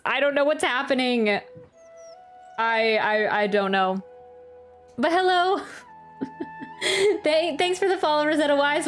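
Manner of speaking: ordinary talk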